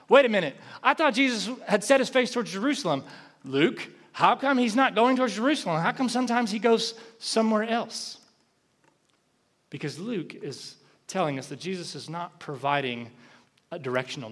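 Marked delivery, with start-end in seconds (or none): 0.1-8.2 higher, louder mock confusion; puzzled reader. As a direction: play the baffled reader questioning Luke. Voicing a puzzled Bible reader.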